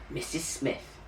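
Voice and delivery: plain reading voice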